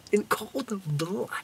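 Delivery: "In cold blood" dropped to a slow hush; dramatic hushed echo. Acted. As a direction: repeat "In cold blood" slowly under the breath. Echoing for drama.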